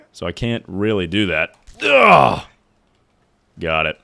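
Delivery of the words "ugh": loud high effort grunt; tennis grunt. Real, not acted. Strained grunt in game.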